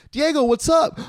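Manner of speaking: high-pitched imitation of friends walking in; excited greeting. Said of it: "and they're like".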